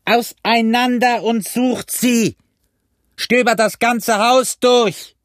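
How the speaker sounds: slow, high roar; drawn-out high bellow; shouted repeated order. A narrator's reading. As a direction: pound out the command word by word. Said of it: "wiederholte Pugh und schlug mit seinem Stock".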